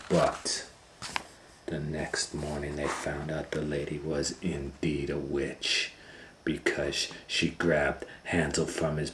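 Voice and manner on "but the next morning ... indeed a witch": ordinary delivery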